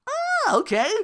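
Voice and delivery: high loud ah okay; mock realization. Reacting to the streamer, a high loud "ah okay". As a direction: pop up in sudden realization. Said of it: "I'm like".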